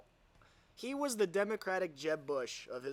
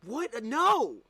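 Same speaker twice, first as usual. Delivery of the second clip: rising, louder fake disbelief; mock shock; 8 semitones higher, 8 dB louder than usual. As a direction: play it like a hammed-up gasp of denial. Mock shock at own photo.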